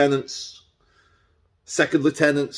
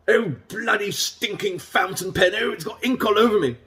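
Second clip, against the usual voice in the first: much higher, faster, fussy old-man mock voice; flustered King Charles; 8 semitones higher than usual. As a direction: camp up a flustered monarch cursing his pen. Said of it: "and the king's like".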